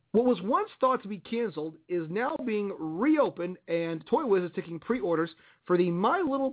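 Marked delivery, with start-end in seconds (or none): none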